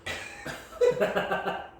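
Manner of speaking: breathless high wheezing laugh; wheezy laugh. Real, not acted. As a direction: laugh while still choking. Beer went down wrong way.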